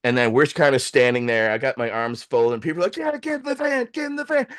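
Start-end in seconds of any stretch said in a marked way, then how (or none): 3.0-4.5 high-pitched mock cheer without volume; imitated cheering crowd. People behind cheering for the van, high mock yelling. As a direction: mimic onlookers egging them on. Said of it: "Now people are cheering".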